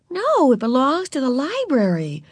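put-on high, emphatic child's voice; big sister explaining. A narrator's reading. Beezus correcting Ramona about the library book, high and firm.

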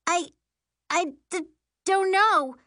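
high, stammering, broken start; panicked stammer. A narrator's reading. Sister losing control of broom, stammering. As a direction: stumble over the words in a high panic. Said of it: "she stammers".

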